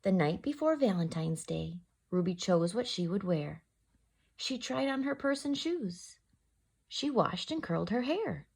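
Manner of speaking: gentle rhyming read-aloud; story narration. A narrator's reading. Teacher reading to children in storytime voice. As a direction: read it like a bedtime picture book. Reads picture book aloud.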